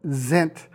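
"zent" begun with a buzzed Z; voiced Z opening on "zent"; Z sound. Shown as a demonstration. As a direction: say "zent" as the root of resent, Z not S. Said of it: "the S changes its pronunciation".

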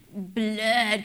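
a hint of Dracula on a single drawn-out word; vampire accent. Shown as a demonstration. Preacher demonstrating for the congregation, mild vampire accent. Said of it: "say it with that vampire accent".

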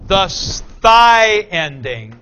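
slow, deliberate "sty ending"; emphatic point. Shown as a demonstration. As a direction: stretch out "The sty ending" slowly to make the point. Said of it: "here's the point".

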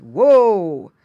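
loud drawn-out whoa; loud stopping call to horses; driver's whoa. Retold or imitated. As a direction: pull the horses up short.

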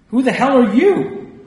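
high, loud, slow screamed question; old patient screaming at the doctor; hoarse scream. A narrator's reading. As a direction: scream it at the stranger. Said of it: "she screamed hoarsely".

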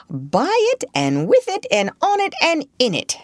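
lightly voiced Rat, lilting list; enthusiastic Rat. Rat tells Mole how he lives by the river, lilting relish. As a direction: lift each phrase high, relishing it.

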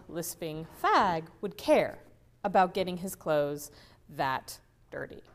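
usual speaking voice

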